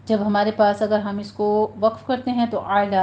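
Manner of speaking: ordinary talk, nothing marked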